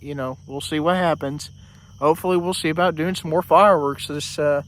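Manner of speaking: normal talking voice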